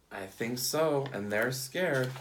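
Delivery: ordinary delivery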